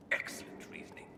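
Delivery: dry whispered mockery; sarcastic whisper. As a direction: mutter it with cold irony. Sarcastic aside.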